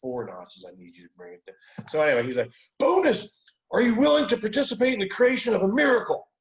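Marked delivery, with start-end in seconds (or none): 0.0-1.9 much lower and softer, winding down; mellow, tipsy request. Retold or imitated. Tipsy friend asking the coach to calm down, low and soft. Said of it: "I'm, like, down". 2.8-6.3 much higher and louder, fired-up question; amped-up coach. Retold or imitated. Hyped coach challenging his client with a booming, high question. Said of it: "he's all amped up".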